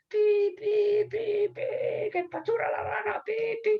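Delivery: very high, piercing whistle imitation; whistle-blowing policeman. Policeman who saw a frog nearly squashed, shrill whistling voice. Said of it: "se pone a pitar".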